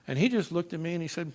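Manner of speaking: usual speaking voice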